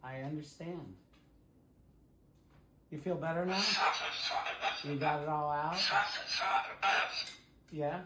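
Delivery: calm the bird down tenderly; soothing. Real, not acted. Owner soothing her parrot after it screamed, soft and gentle.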